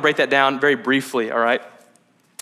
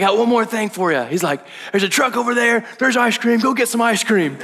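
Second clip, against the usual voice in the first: higher, excited coach call to the team; hyped-up coach; 8 semitones higher than usual. Retold or imitated. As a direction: sell the surprise like a coach rallying players. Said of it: "doing the coach thing".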